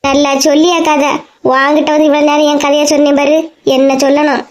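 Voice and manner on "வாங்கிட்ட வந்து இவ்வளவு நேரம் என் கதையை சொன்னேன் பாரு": ordinary talk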